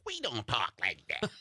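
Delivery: put-on commenters in thick Wisconsin accent. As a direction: imitate a defensive Wisconsin commenter. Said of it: "comments are like".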